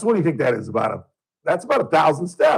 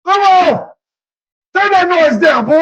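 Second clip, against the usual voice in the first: shouting father, much higher and louder; father's yell; 10 dB louder than usual. Retold or imitated. Father yelling at his son to turn the music down. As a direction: bellow at the kid to turn it down.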